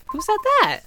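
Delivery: shrill high startled cry. Real, not acted. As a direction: jump at the voice again. Startled again.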